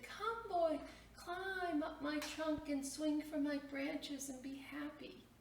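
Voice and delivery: high, slow, sweetly inviting tree; loving tree voice. Acted. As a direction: call the boy up into your branches like a loving tree. Tree calls boy to play.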